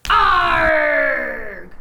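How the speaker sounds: loud growling arg; jump-scare pirate roar; pirate shout. Acted. A puppet pirate roars to startle the reader, loud.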